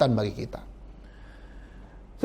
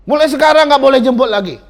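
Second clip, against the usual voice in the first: high, loud scolding; angry father; 9 semitones higher, 9 dB louder than usual. Retold or imitated. Angry father scolding the evangelist at the door, loud and high. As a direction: tell him off, furious. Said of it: "marah-marahin".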